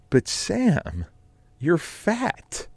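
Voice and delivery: high, teasing voice; Kelly's sultry put-down. Retold or imitated. Kelly teasing Sam with a sultry, high put-down. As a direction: purr it like a cruel flirt. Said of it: "and she goes".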